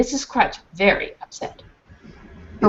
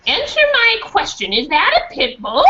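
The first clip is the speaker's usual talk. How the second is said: much higher, insistent and sharp; angry high demand; 7 semitones higher than usual. Acted. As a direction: demand an answer now. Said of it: "eyes were narrow with anger".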